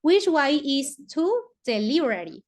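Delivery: slow, careful English; English model sentence. Shown as a demonstration. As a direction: read it out slowly for copying. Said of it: "ese way es camino". (inglés lento y claro para la clase)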